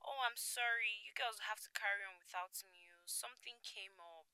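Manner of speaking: soft, distant voice down a phone line. Acted. Friend excusing herself from the night out, phone voice. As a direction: apologise faintly through a phone. Kim heard over phone call.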